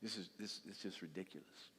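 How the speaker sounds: low, soft, sincere appeal; quiet earnest plea. Real, not acted. Preacher begging parents to live right, soft and sincere. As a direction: plead gently with parents.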